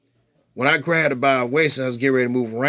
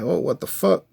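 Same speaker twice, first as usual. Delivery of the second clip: shocked; 7 semitones higher than usual. Retold or imitated. Sees his own blood.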